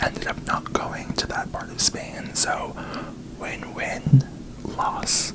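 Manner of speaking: ordinary talk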